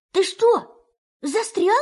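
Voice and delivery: Rabbit's voice. Acted. Rabbit asks stuck Pooh.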